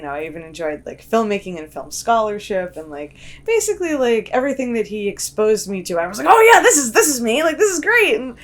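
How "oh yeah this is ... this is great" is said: excited. Retold or imitated. Excited quote of younger self.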